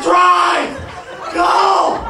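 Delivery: high, urgent mock scream; panicked yell. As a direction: yell it like you're fleeing for your life. Panicked friend fleeing in car.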